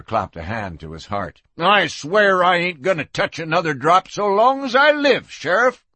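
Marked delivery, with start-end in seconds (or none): 1.6-5.9 put-on high-pitched, earnest drunkard's oath; pleading town drunk. Jailed drunk swearing off drink to sheriff, pleading. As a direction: swear it like a drunk begging for release.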